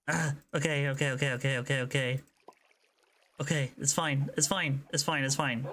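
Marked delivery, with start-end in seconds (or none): none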